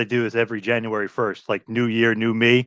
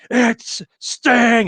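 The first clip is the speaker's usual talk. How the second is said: high, excited announcer cry; Schiavone announcing; 11 semitones higher, 3 dB louder than usual. Retold or imitated. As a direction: belt out a sudden big reveal. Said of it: "Schiavone's completely pissed off, who was so great as an announcer".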